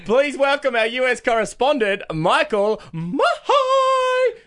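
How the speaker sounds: hammed-up announcer, name stretched very slow and high; big announcer intro. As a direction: roll out the name like a game-show host. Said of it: "one of us needs to do it".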